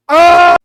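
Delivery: high, loud scream of rage; frustrated scream. Real, not acted. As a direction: let out a furious yell. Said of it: "I am calmer now".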